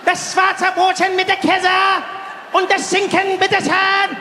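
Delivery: high, slow shouted German oration; Führer-like inflection. Acted. Customer ordering a sandwich in German, Führer-like shout. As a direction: shout each phrase like a rally speech. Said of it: "The way I inflect on certain words has a certain, uh, Führer feeling".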